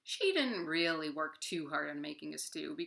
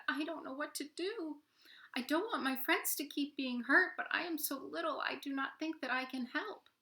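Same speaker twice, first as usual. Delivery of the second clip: timid little spider; 9 semitones higher than usual. Acted. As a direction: sound tiny and helpless. Spider's small voice.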